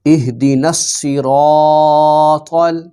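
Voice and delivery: اهدنا الصراط drawn out slowly, the alif of الصراط held long; slow tajweed chant with the madd on الصراط; slow Quran recitation. Shown as a demonstration. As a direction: recite الصراط stretching the alif by one count. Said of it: "ایک الف کے برابر لمبا کرنا ہے".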